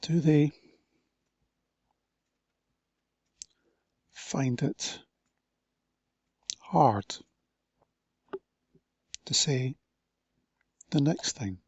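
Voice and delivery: long pauses between words; hesitant, broken-up speech; slow, halting. Shown as a demonstration. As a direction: struggle to find each word. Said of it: "The speed of a conversation".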